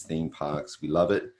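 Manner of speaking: ordinary talk, nothing marked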